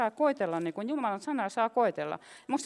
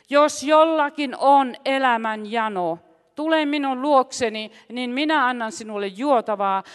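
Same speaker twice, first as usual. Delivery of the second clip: loud, emphatic proclamation in Jesus' words; forceful preaching; 6 dB louder than usual. Acted. Preacher voicing Jesus' promise to the thirsty, forceful proclamation.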